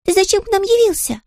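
very high-pitched chick voice; squeaky chick. A narrator's reading. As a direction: demand an answer in a tiny squeak. Tutta the chick asks, squeaky.

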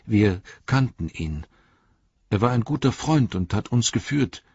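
high, fast hobbit voice with a touch of sadness; a young hobbit remembering his lost friend; hobbit Pippin. Acted. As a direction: Pippin telling the Ent about Gandalf. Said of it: "sagte Pippin traurig".

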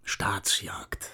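hushed whispered secret. Acted. Plotter whispering his plan to an old friend, hushed. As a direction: let the word slip out as a secret.

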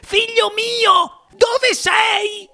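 very high, drawn-out wail; shrieking mother. A narrator's reading. Mother searches for her vanished son, wailing shriek. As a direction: wail frantically for a missing child. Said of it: "strillò la signora T V".

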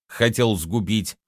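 ordinary delivery

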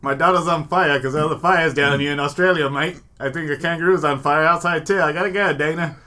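put-on Australian accent. [name] calling Dana about the fire, mock Australian accent. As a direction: put on a broad Australian accent, loud and lifted. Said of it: "He's Australian".

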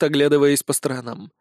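ordinary delivery, nothing marked

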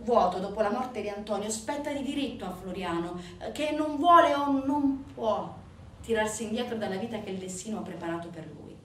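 usual speaking voice

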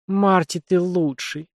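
mild squeal. A narrator's reading. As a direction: squeal with glee. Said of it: "взвизгнула Аннабель". (радостно взвизгнув)

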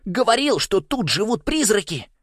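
put-on very high, excited boyish outburst; excited exclamation. A narrator's reading. Нэш insists to the others about ghosts, exclaiming. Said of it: "воскликнул он".

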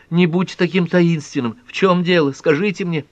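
high-pitched, insistent pleading; agitated, high demand to be told; urgent demand. Acted. Pat shakes Ellery, demanding answers.

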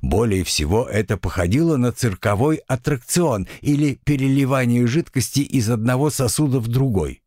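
ordinary delivery

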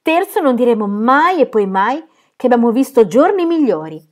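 loud, high, hammed-up tragic tone; mock pathos. Phyllis mocks their hardship to her sister, hammed-up pathos. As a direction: play at grand despair. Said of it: "con finto pathos".

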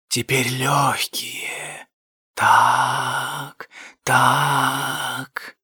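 rustling whisper, soft and slow; dark whisper behind you. A narrator's reading. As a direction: breathe it slowly from behind. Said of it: "прошелестел чей-то голос за спиной".

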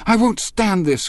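high, loud, distressed cry. A narrator's reading. Daisy pleading to stop the quarrel, high and loud. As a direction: break out of her in a pitch of panic. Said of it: "cried Daisy".

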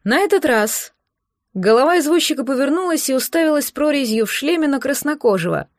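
plain reading voice

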